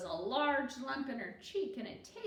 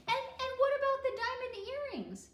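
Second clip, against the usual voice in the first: put-on very high, loud little-girl question; a kid bursting to ask; eager child; 14 semitones higher, 7 dB louder than usual. A narrator's reading. Child Tricia asks eagerly.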